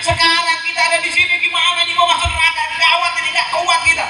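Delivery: high-pitched, agitated protest; acted outcry demanding answers from Iblis; confronting the devil. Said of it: "Yang di sini tanya sama iblis".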